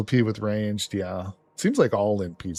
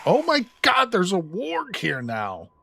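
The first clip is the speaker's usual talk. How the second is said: startled; 10 semitones higher than usual. Real, not acted. Warg suddenly appears in building.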